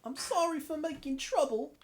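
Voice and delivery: pleading servant voice. Acted. Voicing a grateful follower to his lord, squeaky pleading voice. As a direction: beg your lord with a squeaky, eager cry.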